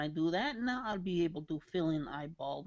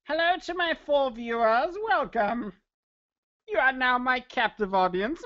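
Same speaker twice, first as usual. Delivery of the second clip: loud, raised villain greeting ending in a cackle; mock villain; 6 semitones higher, 6 dB louder than usual. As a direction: gloat like a hammy villain over captives. Playing villain to viewers, evil laugh.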